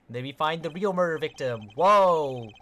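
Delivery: loud drawn-out whoa of surprise; mock shock. Reading game dialogue with mock shock.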